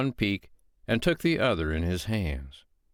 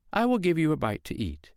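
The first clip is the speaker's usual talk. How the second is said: mild, higher, innocent housewife voice; sweet-voiced woman; 8 semitones higher than usual. Foster mother calmly lying to the giant, innocent tone. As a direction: play the calm mother who is fooling the giant.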